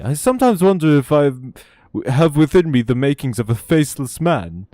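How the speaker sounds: high, affected sitcom nobleman voice; Lord Percy impression. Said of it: "I did Percy's voice a little too well".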